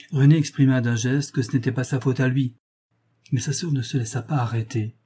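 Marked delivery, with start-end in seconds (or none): none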